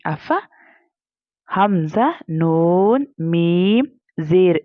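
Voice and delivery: drawn-out letter-by-letter Quranic spelling; slow spelling recitation. Shown as a demonstration. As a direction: chant each letter and vowel like a tajweed teacher. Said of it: "spelling out the word".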